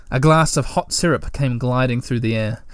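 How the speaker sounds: plain reading voice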